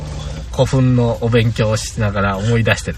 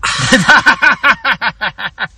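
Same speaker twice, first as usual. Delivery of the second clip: high laugh; 11 semitones higher, 4 dB louder than usual. Real, not acted. Laughs after the catchphrase.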